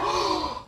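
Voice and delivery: sharp intake of breath; sudden gasp. Acted. Man sees himself in the bee, gasp of realization.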